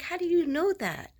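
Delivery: high, amazed disbelief; astonished question. Retold or imitated. To the psychic, a high astonished question. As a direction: gasp it out in shock.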